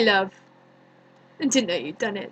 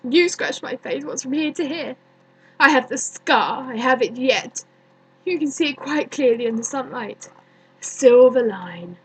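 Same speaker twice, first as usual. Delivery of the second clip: louder, resentful accusation; bitter grievance; 5 dB louder than usual. Acted. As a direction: build an old childhood grudge into open indignation. Bitter childhood grievance at dead Juliet.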